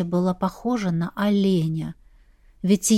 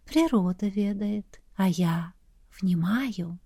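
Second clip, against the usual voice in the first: windy whisper; 6 dB quieter, slower than usual. A narrator's reading. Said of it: "будто ветерок шелестел".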